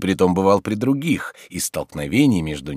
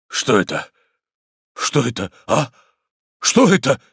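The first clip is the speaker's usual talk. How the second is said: stunned, short bursts getting higher and louder; gasping shock; 6 semitones higher, 5 dB louder than usual. Acted. Tarantyev holds his slapped cheek, gasping shock. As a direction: gasp it out between stunned pauses. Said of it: "задыхаясь".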